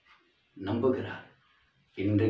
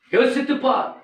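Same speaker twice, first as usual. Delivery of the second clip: யோசித்துப் said much higher and louder; loud preaching call; 13 semitones higher, 6 dB louder than usual. Shown as a demonstration. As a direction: cry out the warning to think. (உரத்த குரலில் அழைப்பு)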